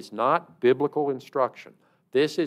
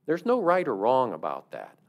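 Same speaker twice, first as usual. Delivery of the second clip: ordinary talk, nothing marked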